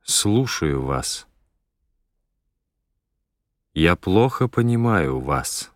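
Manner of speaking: ordinary talk, nothing marked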